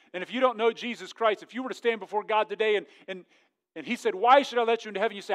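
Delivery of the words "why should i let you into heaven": raised, quick challenge; louder, higher acted question; stern questioning. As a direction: demand an answer as judge. Acts God questioning a person.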